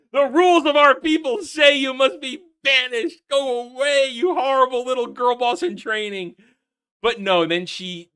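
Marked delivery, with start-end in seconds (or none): 0.1-6.4 high, loud mock pronouncement; sneering elder sends the girl away. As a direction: banish her with fake solemnity. Mocking Harfoot elder.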